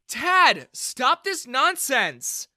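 high, loud scolding shout; angry protest. A narrator's reading. Daughter rushing in to stop her father, angry. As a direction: cut him off at full voice.

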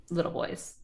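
ordinary talk

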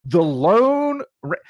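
slow, high hammed band-name call, cut off; goofy announcer voice. Retelling the movie to friends, slow hammy band-name call. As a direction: start the cheesy announcement again then break off. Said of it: "I say that like that all the time".

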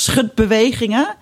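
usual speaking voice

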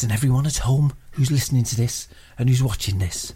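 usual speaking voice

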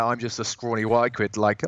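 ordinary talk, nothing marked

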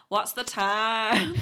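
loud, drawn-out mock Yorkshire accent. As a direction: belt it out in hammy Yorkshire, stretching the vowels. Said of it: "I could do a brilliant Yorkshire accent".

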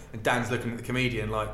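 normal talking voice

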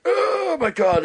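long, high-pitched drawn-out groan; mock shock. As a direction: recoil vocally from blinding daylight, hammed up. Opens door onto bright morning light.

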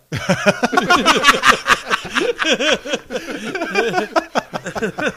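long high roaring laugh; loud burst of laughter. Real, not acted. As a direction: crack up at the punchline. Group laughing at the story.

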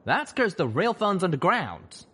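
high, slow drawled retort; retorting cowboy voice. Acted. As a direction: snap back, slow and wry. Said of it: "Calamity retorted".

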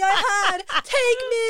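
high, loud, arms-wide offering; hammed-up child begging the monkey; dramatic calling out. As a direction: cry out to be taken.

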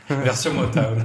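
laughing. Real, not acted. Repeating a joke while laughing.

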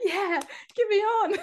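high, slow, eager shout; psyched up. Retold or imitated. Sub begging the coach to send her on, high and eager. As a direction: sound pumped to get on. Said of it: "I was just like".

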